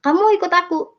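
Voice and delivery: high, startled question; a light imitation of the startled husband; surprised husband. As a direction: ask it in disbelief as the husband. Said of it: "Kaget suami saya".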